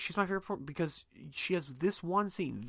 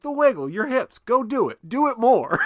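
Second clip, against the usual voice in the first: much higher and louder, laughing as they egg him on; hyped-up urging; 10 semitones higher, 9 dB louder than usual. Retold or imitated. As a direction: push him on, shouting with glee. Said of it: "And they're like".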